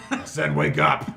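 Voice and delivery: exasperated wake-up yell; insistent shout. Acted. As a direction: holler it at someone who won't get up. Boss repeats his order, yelling.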